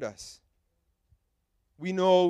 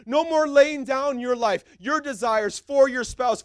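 normal talking voice

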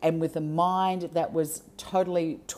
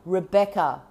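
calling her name with more intention. Real, not acted. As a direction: call "Rebecca." with stronger intention, to reach her. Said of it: "And then I just turned up the intention".

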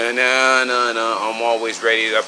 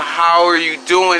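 ordinary talk

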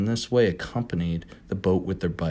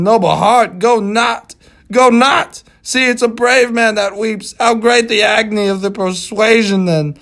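tearful plea; 13 semitones higher, 10 dB louder than usual. Acted. Starbuck weeps, pleads.

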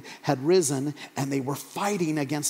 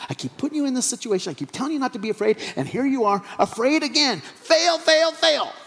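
mock scolding; 8 semitones higher than usual. Jesus jokingly failing frightened disciples, mock scolding. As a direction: build a hammed-up telling-off.

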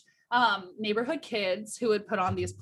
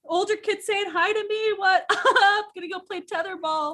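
high giggly mock kid voice; laughing; 10 semitones higher, 6 dB louder than usual. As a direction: laugh through an imitation of older kids. Mimicking older kids, laughing.

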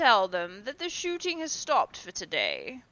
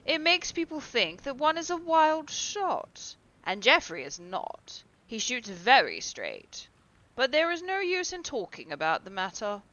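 airy dismissive; 7 semitones higher, 8 dB louder than usual. A narrator's reading. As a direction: wave it off as trifling. Lord Henry dismisses the accident.

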